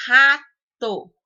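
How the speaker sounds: Rato loud, with RA punched and held; stress on first syllable. Shown as a demonstration. Teacher giving a paroxytone example, stress on RA in Rato. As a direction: hit the RA of Rato. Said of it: "Ra é mais forte".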